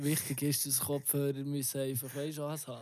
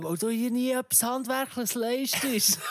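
cutting retort; 10 semitones higher, 5 dB louder than usual. Retold or imitated. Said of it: "und er sagt so".

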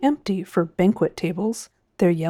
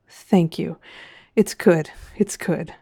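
plain reading voice

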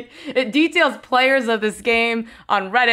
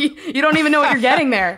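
quick words through real laughter; laughing, fast; 5 semitones higher, much faster than usual. Real, not acted. Cohost riffs on tarantula risk, laughing fast. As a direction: rush it out while cracking up. Joking about buying tarantulas.

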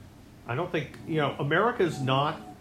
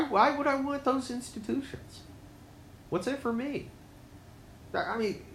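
mock indifference; 8 semitones higher than usual.

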